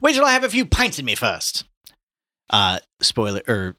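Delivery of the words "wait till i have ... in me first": high, loud theatrical wail; Buck Mulligan shouting in pain. A narrator's reading. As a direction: protest at full pitch, hammed-up suffering. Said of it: "Buck Mulligan shouted in pain".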